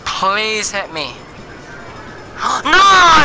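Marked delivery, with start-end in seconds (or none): 1.4-2.6 sharp gasp. Real, not acted. Train almost hits him. 2.6-3.2 startled shout. Real, not acted. Train just misses him.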